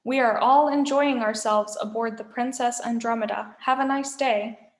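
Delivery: ordinary delivery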